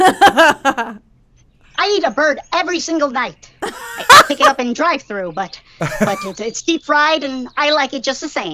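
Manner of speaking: scary voice changer effect; voice modulator. Shown as a demonstration. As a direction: demon voice through a modulator. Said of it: "that voice coming out of you".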